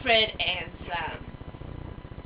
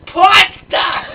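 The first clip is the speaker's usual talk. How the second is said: pasta! shouted loud and high; excited shout; 10 semitones higher, 14 dB louder than usual. Acted. Character answers hosts with a shouted pasta!. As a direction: blurt out pasta! with gusto. Character exclaims favorite food.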